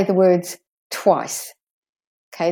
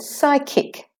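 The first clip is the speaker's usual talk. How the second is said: "psychic" high, SY-KIK, both vowels full; both syllables stressed; 7 semitones higher than usual. Shown as a demonstration. Teacher modelling for learners, "psychic" with two strong syllables. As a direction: give both halves of "psychic" weight. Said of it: "Both syllables".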